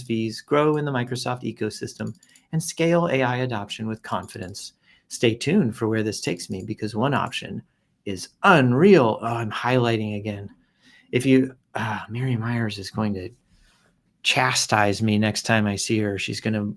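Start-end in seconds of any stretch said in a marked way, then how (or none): none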